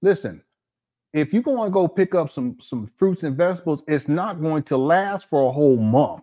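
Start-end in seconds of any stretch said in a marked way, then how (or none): none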